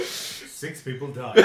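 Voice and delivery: breathless slow joke through laughter; laughing. Real, not acted. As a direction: gasp it out while laughing. Cohost cracking up at story.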